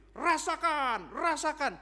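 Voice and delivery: loud, high, drawn-out taunt; hammed-up cry of spite, stretching the word; mock vengeful gloating. Hater relishing revenge on someone else, exaggerated spiteful gloating. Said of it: "orang yang menyimpan kebencian".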